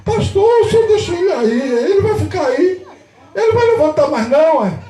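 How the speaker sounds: high, loud acted protest; alarmed parent. Parent asking the pastor about fallen son, alarmed. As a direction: imitate a worried parent pressing for an answer.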